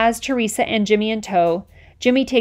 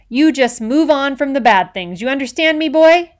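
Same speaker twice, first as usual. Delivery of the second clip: put-on louder and rising to a bark on "boy"; forceful demand; 5 semitones higher, 6 dB louder than usual. A narrator's reading. Officer demanding Moose agree, loud and rising. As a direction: hammer the point home.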